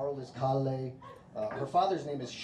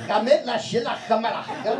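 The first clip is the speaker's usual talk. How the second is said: louder, higher fake Swedish gibberish; 8 semitones higher, 6 dB louder than usual. Husband answering his wife with louder, higher mock Swedish gibberish. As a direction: spout nonsense like it's Swedish. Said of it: "So I'm like".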